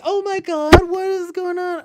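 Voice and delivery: high, loud mock exclamation of a young girl; a hint of a shocked little girl. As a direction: squeal it like a startled girl. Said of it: "I thought they were gonna say".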